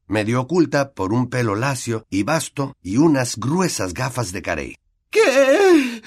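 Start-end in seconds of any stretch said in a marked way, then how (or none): 5.1-6.0 high, loud sulky snap. A narrator's reading. Myrtle snapping at the group, sulky and high. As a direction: answer like a sullen ghost girl. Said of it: "preguntó enfurruñada".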